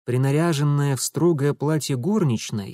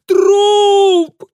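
very high, loud terrified shriek; piercing scream; 11 dB louder, much slower than usual. Acted. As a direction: scream in horror. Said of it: "пронзительным вибрирующим воплем".

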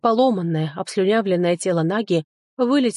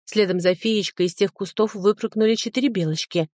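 ordinary delivery, nothing marked

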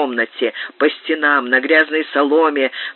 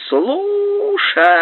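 very high piercing call; shout into a tube. A narrator's reading. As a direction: yell it down the speaking tube. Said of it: "закричала в неё".

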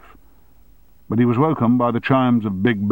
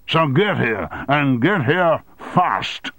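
slow, hammered get here fast; emphatic order; 6 semitones higher, slower than usual. Acted. Chairman ordering his deputy in, emphatic. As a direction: punch each get.

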